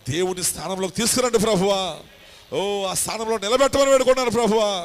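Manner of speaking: loud, high, impassioned intercession; fervent prayer. Acted. Pastor praying over the live audience, loud and fervent. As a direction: cry out to God with full force.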